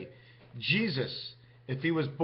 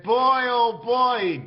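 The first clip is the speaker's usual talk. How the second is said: loud, slow and higher, stretched out in wonder; amazed outcry; 7 semitones higher, 9 dB louder, much slower than usual. Retold or imitated. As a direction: cry out like seeing a miracle. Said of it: "They said".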